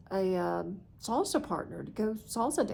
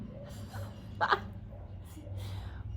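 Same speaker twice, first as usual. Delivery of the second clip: breathy silent laugh; 8 dB quieter than usual. Real, not acted. Laughs at her own naivety.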